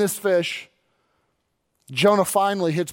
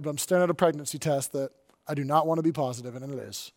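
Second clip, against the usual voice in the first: hushed dread; 6 semitones lower, 7 dB quieter, faster than usual. Retold or imitated. Imagined dread, voice falls away.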